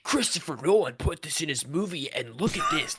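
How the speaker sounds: high, excited mocking fan voice; mock hype. Mocking hyped fan theories.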